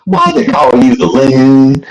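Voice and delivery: slow, teasing mock Southern accent; fake Southern accent. Caller jogging the host's memory, fake Southern accent. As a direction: drag it out in a put-on Southern accent. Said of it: "I had my fake Southern accent".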